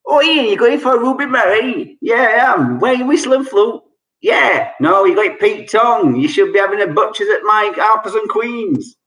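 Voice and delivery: high, quick Cockney back-and-forth; Cockney dialogue. Shown as a demonstration. Quizmaster acting a slang-packed chat, hammed Cockney. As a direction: play two London mates bantering. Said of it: "if you put it all in one sentence".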